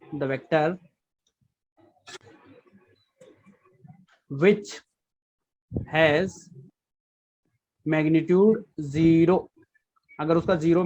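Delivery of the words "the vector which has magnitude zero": each word spaced out slowly for notes; slow dictation. Shown as a demonstration. Teacher dictating a definition slowly to students. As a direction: dictate it slowly so they can write. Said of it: "लिख दीजिए इसको".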